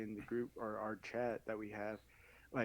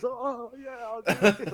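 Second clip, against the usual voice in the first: excited yell; 11 semitones higher, 9 dB louder, slower than usual. Retold or imitated. Fighter eagerly accepting a call-out.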